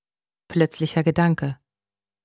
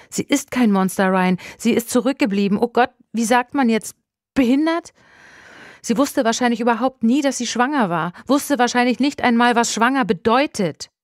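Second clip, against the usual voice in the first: higher-pitched, agitated outpouring; fighting tears; 5 semitones higher, 3 dB louder than usual. A narrator's reading. Telling Ryan about Ruben after running, fighting tears. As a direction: let it tumble out, close to crying. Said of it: "zitterte, kämpfte mit den Tränen".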